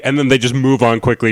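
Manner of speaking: usual speaking voice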